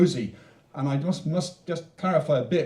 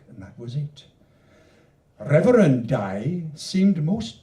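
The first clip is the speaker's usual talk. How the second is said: louder, higher, swinging recital with hushed refrain ends; dramatic poem recital; 4 semitones higher, 6 dB louder, slower than usual. Acted. Poet performing his bawdy Welsh canto for the audience. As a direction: perform it, building up, then drop to a hush.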